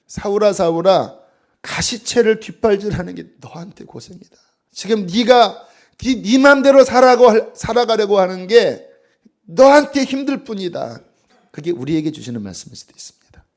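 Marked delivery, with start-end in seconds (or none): 0.1-11.0 growing louder and higher to a cry; building rebuke. Acted. The Lord pleading with stubborn Saul, rising louder. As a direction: climb from firm to an urgent high call. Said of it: "주께서 말씀하시기를".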